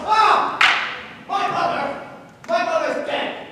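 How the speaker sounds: high, loud wail of grief; anguished cry. Acted. Big man crying out about his dead mother. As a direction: cry out as if freshly wounded.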